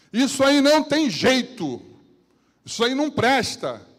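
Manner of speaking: bem alto e agudo, devagar, desprezando; desdém exagerado. Retold or imitated. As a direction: despreze como quem desistiu. Imitando quem desiste de alguém. (imitando quem diz que não tem jeito, alto e desdenhoso)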